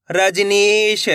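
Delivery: रजनीश with a long stretched नी; blended syllable reading. Shown as a demonstration. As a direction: draw out रजनीश for learners. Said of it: "एक अक्षर का उच्चारण सही से". (रजनीश को खींचकर)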